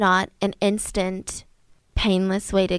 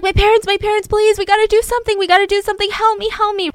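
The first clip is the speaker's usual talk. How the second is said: high, loud, rushed panicked plea; frantic begging; 10 semitones higher, 5 dB louder, faster than usual. Retold or imitated. Son begging the neighbor for help, high and frantic. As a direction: beg them to do something, panicking.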